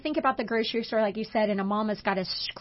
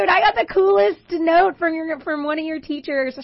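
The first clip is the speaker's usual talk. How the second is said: excited; 5 semitones higher, 9 dB louder than usual. Retold or imitated. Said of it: "I started smiling".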